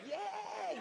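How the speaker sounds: high, thin drawn-out cheer; excited falsetto whoop. Retold or imitated. Cheering up on his chair.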